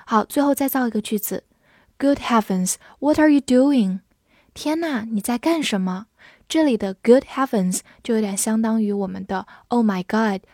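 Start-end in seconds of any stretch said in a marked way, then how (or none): none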